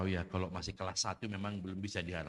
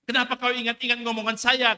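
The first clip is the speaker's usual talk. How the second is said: higher and much louder, an acted furious cry at God; angry outburst; 14 dB louder than usual. Preacher voicing the sailor yelling angrily at God. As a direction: shout it like a man whose house just burned. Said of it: "Dia marah".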